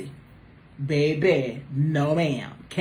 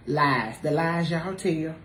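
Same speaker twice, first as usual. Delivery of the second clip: usual speaking voice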